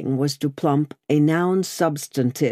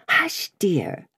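whispery, high-pitched shushing; hushed remonstrance; 4 semitones higher than usual. A narrator's reading. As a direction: gently shush her under the breath. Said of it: "Lucy remonstrated".

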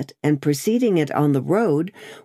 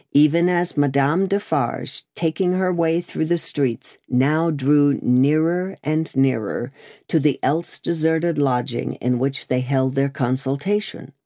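ordinary delivery, nothing marked

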